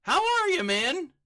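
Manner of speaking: loud, high-pitched shouted greeting; excited yell. Real, not acted. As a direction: holler a delighted hello to a friend. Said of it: "I just yelled into the mic".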